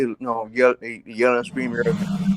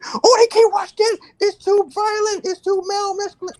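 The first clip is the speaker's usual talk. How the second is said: mock teacher voice. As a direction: whine it like a prissy substitute teacher. Teacher objects to He-Man.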